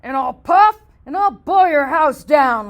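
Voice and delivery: loud mocking threat; wolf laughing threat. Wolf mocking the pigs, loud and gleeful. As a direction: boom it out with a laugh. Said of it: "laughed the wolf".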